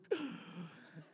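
sharp mock gasp of delight. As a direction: imitate being smitten with a sudden gasp. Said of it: "And I'm just like".

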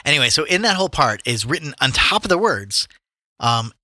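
usual speaking voice

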